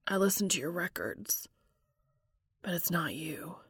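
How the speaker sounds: soft unvoiced whisper. A narrator's reading. Dean telling Cass the house feels empty, whispering. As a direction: let it fall to a whisper.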